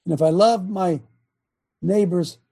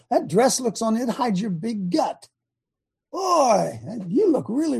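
mock flattery; 6 semitones higher than usual. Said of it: "We all wanna say".

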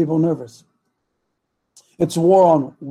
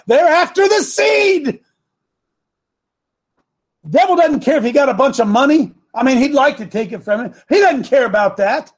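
high, loud preacher's shout; full-force preaching; 7 semitones higher, 4 dB louder than usual. Real, not acted. Coach warning the audience about their children, full-force preaching. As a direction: burst into a raised, fervent sermon voice.

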